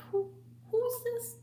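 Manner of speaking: high, squeaky suspicious cat. Retold or imitated. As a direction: resident cat eyeing the newcomer. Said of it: "her cat was like".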